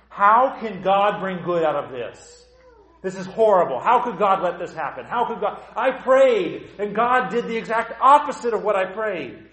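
loud, pleading protest to God; loud voice of someone hurt and complaining; anguished complaint. Acted. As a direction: cry out at God in frustration. Congregant voicing anguished complaint to God.

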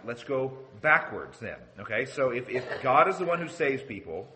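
normal talking voice

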